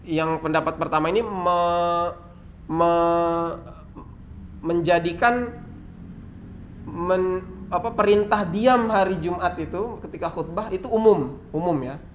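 ordinary talk

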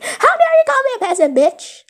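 high, loud, fast outraged yell; indignant screaming retort; furious shout. Acted. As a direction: blast her with outrage.

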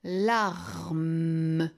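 "Larme" stretched, each sound held into a long M; "Larme" drawn out with a hummed ending. Shown as a demonstration. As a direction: blend "Larme" slowly, la-rrr-mmm. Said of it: "La première syllabe a trois sons".

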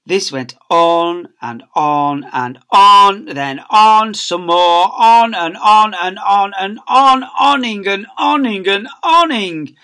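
slow, loud, drawn-out telling; dragged out on and on. A narrator's reading. Narrator dragging out the endless assembly, slow and loud. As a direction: stretch every on endlessly. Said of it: "narrator drags the words".

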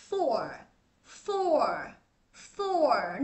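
"four" ending in a curled-tongue r; careful "four" with r-coloured ending; American r in "four". Shown as a demonstration. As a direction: draw out "four" into a gentle American r. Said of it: "cong cái lưỡi ra xíu".

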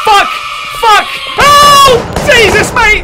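high startled scream then curse; terrified shriek. Real, not acted. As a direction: shriek in real fright. Jump scare, shrieks.

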